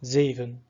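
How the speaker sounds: zeven loud on ze, ven reduced to a schwa; stress on first syllable. Shown as a demonstration. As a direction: hit ZE in zeven. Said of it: "the stress falls on the E part".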